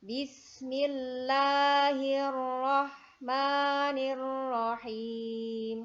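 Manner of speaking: slow, loud melodic chanting; Quran recitation. Shown as a demonstration. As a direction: chant it slowly like murottal. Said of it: "Kita lanjutkan basmalah".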